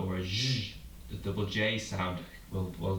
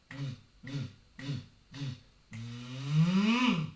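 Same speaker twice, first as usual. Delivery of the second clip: buzzy v crescendo; 5 semitones higher, much slower than usual. Shown as a demonstration. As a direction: swell the v buzz over the beats. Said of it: "Let's do it on a v sound".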